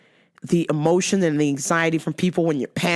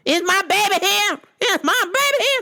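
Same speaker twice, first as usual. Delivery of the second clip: wrong mom impression; 13 semitones higher than usual. Imitating mom the wrong way at a high squeaky pitch. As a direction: play the wrong version of mom, high and cartoonish. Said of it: "she doesn't talk like that".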